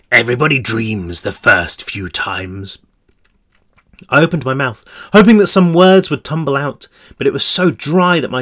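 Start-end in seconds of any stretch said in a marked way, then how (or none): 0.1-2.8 sinister warden. Acted. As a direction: purr it like a cruel warden. Narrator voices the warden.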